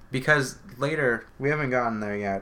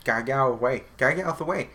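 raised-voice mock road rage, building higher; screaming road rage; 3 semitones higher, 4 dB louder, much faster than usual. Taxi driver at swerving car ahead, mock road rage. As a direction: urge the car aside, mock exasperated. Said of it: "he was screaming".

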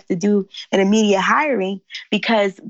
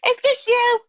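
surprised stranger. Retold or imitated. Stranger recognizes her on plane.

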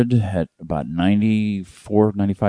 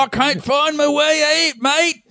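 much higher, slow mock Australian yelling; slower than usual. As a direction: belt it out as a lost Australian. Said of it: "I don't know why we're yelling".

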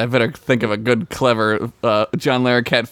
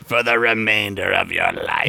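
playful sentencing voice; lightly played solemn verdict; mock tribunal judge; 3 semitones lower than usual.

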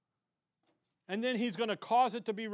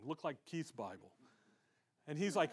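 usual speaking voice

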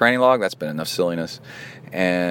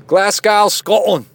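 loud, high hammed-up Scottish accent; 13 semitones higher, 8 dB louder, slower than usual. Host rereading listener's email, mock Scottish accent. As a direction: launch into a big mock Scottish brogue. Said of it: "Should I read it with my Scottish accent?".

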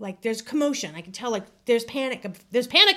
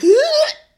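sharp gasp; 12 dB louder than usual. Retold or imitated. Reliving the moment of relief.

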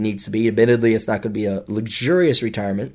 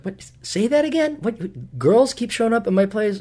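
incredulous; 10 semitones higher, 5 dB quieter than usual. Retold or imitated. Stunned by friend's bragging.

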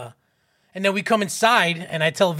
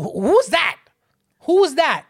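put-on loud, high-pitched child's questioning; acted eager curiosity; 9 semitones higher, 7 dB louder than usual. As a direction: pounce on the question like a nosy kid. Child demands to know about strangers.

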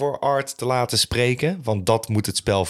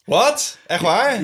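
mock shock; 11 semitones higher, 5 dB louder, much slower than usual.

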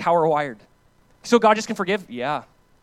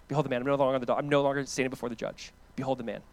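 hushed reverence; 4 semitones lower, 6 dB quieter, faster than usual. Acted. Hushed repeated proclamation.

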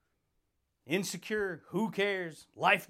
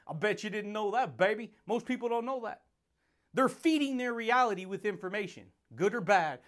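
ordinary talk, nothing marked

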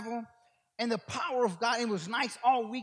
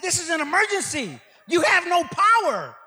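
loud, higher-pitched mock indignation; indignant retort; 7 semitones higher, 9 dB louder than usual. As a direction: protest the absurd rule, hammed up and slowing. Retold argument with hotel staff.

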